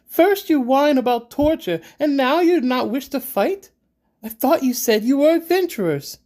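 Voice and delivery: loud, very high indignant outburst; interrupting angrily. A narrator's reading. Siba cutting off Reginald's objection, indignant. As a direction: cut in scornfully at high pitch. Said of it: "Siba interrupts".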